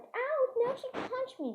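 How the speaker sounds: very high mock yelp; acted pain cry. Storyteller tattling after a punch, acted pain cry. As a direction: whine it high as if just punched.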